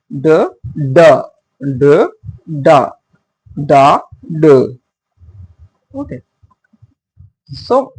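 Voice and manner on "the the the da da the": the, the, da, da, chanted as a beat; da-DUM rhythm. Shown as a demonstration. Teacher chanting the iambic beat to the class. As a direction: tap out the iambic beat with syllables. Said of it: "Pronunciations like".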